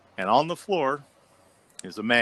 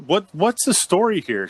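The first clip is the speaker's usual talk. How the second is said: pressing question; 11 semitones higher, 6 dB louder than usual. Retold or imitated. Staff demanding explanation.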